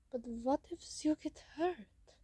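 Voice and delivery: put-on lightly accented character voice; put-on voice for a worried friend; acted worry in character; worried robot character. A narrator's reading. Blitzwing's voice, worried question.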